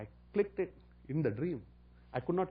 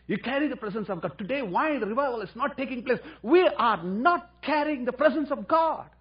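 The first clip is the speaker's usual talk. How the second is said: preaching at full force; 8 semitones higher, 7 dB louder than usual. Real, not acted. Preacher driving his point to the congregation. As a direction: hammer each word of the message home.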